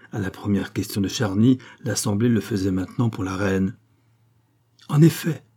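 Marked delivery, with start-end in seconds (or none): 4.9-5.4 higher, louder indignant opening; a sort of fury. A narrator's reading. The queen rounds on Charny, half furious. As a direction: burst out with contained fury. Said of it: "avec une sorte de fureur".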